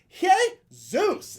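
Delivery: rally the crowd with a cry; shout. Pretend. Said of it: "I'm just up there like".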